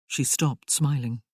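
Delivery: usual speaking voice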